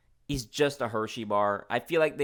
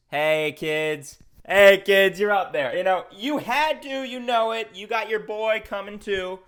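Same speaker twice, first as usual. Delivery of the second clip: excited cheer; 6 semitones higher, 6 dB louder than usual. Real, not acted. Cheering the candy mascots.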